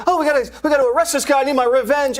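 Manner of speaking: high-pitched, rapid, giddy rant; giddy hysterical impression. Reporter imitating a witness's podcast rant, giddy and hysterical. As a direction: rush it out high, mimicking hysteria. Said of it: "high-pitched, giddy, hysterical voice".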